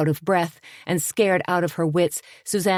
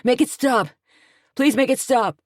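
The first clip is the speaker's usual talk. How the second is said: higher, desperate begging; sobbing plea; 9 semitones higher, 4 dB louder than usual. A narrator's reading. Man covering his head begging the explosions stop. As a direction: plead for the noise to end. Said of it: "he sobbed".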